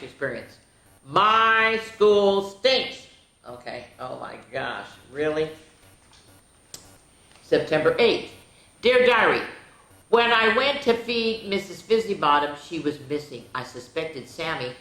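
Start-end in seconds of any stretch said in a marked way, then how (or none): 1.1-3.1 slow emphatic title. A narrator's reading. Emphatic title read again. 8.8-14.7 lively storybook read-aloud, a bit higher; animated picture-book narration; reading to children. A narrator's reading. Reads diary entries aloud.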